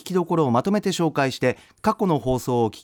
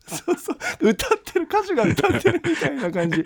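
laughing; 10 semitones higher than usual. Real, not acted. Host cracking up at guest.

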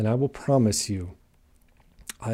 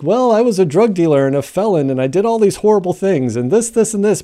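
rattling off his past; 8 semitones higher, 8 dB louder than usual. Retold or imitated. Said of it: "And be like".

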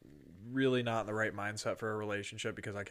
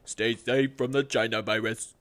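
announcer voice; 12 dB louder than usual. Pretend. Sign-off in announcer voice.

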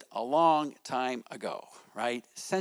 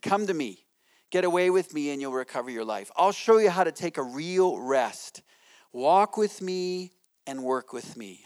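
scripture reading; 2 semitones higher, 5 dB louder than usual. Acted.

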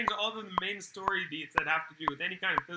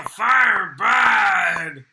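monster shout; 3 semitones higher, 13 dB louder than usual. Retold or imitated. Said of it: "those aren't words".

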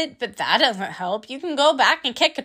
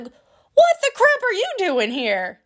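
startled; 8 semitones higher than usual. Retold or imitated. Roommate walks in finding crying friend.

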